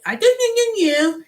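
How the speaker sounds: high, sing-song mock Indian accent; mocking Indian accent trailing into blah blah. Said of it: "They all got that".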